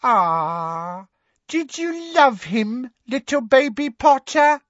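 high, slow, drawn-out taunt; mocking baby talk. A narrator's reading. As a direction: coo with fake pity as if to a baby. Bellatrix's mock baby voice taunts Harry.

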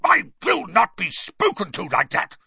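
high, loud, outraged uncle; trembling with rage. A narrator's reading. Uncle rebuking cheeky nephew, trembling with rage. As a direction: explode with indignant rage. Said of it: "trembling with rage".

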